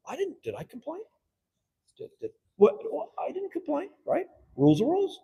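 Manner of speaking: high, indignant denial; protesting colleague. Retold or imitated. Flustered council member protesting he never complained. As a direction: play the flustered member defending himself.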